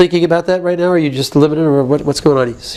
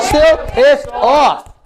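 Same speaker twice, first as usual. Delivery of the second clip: very slow, higher, each word hammered; demonstrated angry outburst; 5 semitones higher, much slower than usual. Shown as a demonstration. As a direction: drag out every word in mounting anger. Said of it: "Say it like that".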